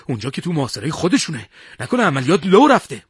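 agitated, worried outburst. A narrator's reading. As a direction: ask in rising alarm, growing loud. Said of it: "با نگرانی پرسید".

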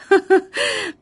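high, loud burst of laughter. A narrator's reading. Mother laughs loudly at her son's answer. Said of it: "بلند بلند خندید".

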